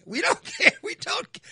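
a real laugh breaking into the rant; laughing shout. Real, not acted.

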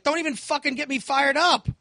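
worked-up, high-pitched warning; retold irritation, voice climbing; angry. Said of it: "and I get angry at my wife".

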